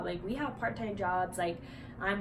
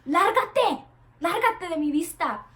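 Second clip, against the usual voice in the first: Spanish brush-off; 7 semitones higher, 8 dB louder than usual. Retold or imitated. Sal de aquí, en voz alta y lenta. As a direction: bark it out in Spanish at the customer. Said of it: "And I'm like".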